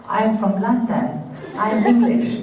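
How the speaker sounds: put-on high, loud, careful kid's English accent; practiced English accent. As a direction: declare it like a child rehearsing an English accent. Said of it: "practiced to do an English accent".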